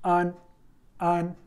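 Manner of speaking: अन said short and clear as Chinese an; an sound demo. Shown as a demonstration. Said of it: "इसे हम कैसे पढ़ेंगे?". (अन को साफ़ और छोटा बोलकर दिखाना)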